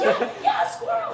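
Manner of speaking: very high, thrilled yes-yes; excited agreement. Retold or imitated. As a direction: imitate his joy at being understood. Man thrilled at being understood.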